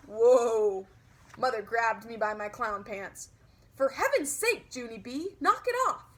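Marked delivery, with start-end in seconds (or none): 3.8-6.0 yelling. Acted. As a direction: scold the child sharply. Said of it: "she yelled".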